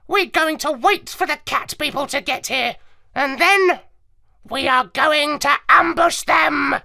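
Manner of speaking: squawky parrot, rising to a fierce cry; high parrot voice, building to a shout. Acted.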